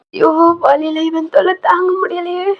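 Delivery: high, wailing complaint; acted labour-pain whine; pained exasperation. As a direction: moan in pain and annoyance. Labouring wife fed up with husband.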